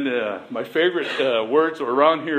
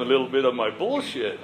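ordinary delivery

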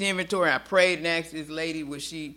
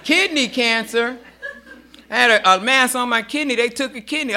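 laughing; 6 semitones higher, 4 dB louder than usual. Real, not acted. Laughing about cancer.

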